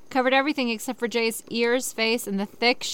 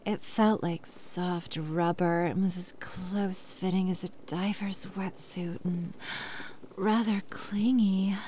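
soft, low, husky sexy voice; 4 semitones lower, 6 dB quieter than usual. Shown as a demonstration. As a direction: purr it seductively. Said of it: "let's say it again in a sexy voice".

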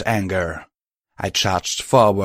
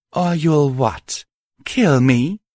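mocking titter; 6 semitones higher, 3 dB louder, slower than usual. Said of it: "Cruelty tittered".

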